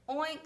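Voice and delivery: a high little oink; pig oink. A narrator's reading. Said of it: "said Pig and Pig".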